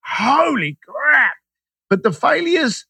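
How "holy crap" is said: awed holy crap. Retold or imitated. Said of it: "And then I've gone".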